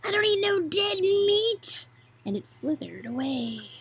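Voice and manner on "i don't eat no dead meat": higher hissing snake. Acted. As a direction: sneer it like a snake. Snake speaks.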